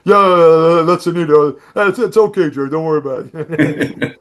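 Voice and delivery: mock deep-voiced easygoing reassurance. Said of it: "he had that deep voice".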